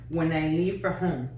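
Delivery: usual speaking voice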